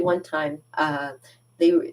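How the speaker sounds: normal talking voice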